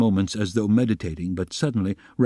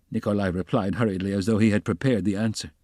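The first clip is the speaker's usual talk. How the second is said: ordinary delivery, nothing marked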